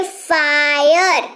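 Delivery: "फायर" with a long, slow आय; drawn-out "आय" sound. Shown as a demonstration. Teacher showing students the आय in "फायर", drawn out. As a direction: stretch the आय inside "फायर". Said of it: "आय, सुनाई दे रहा है?".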